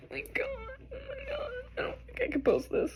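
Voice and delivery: soft, broken crying; real sobs trailing off; weeping, almost inaudible; sobbing. Real, not acted. Crying over the reading.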